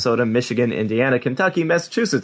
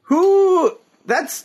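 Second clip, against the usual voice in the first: surprised. Real, not acted. Disbelief at the ad.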